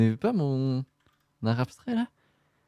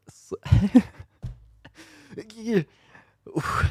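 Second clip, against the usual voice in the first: normal talking voice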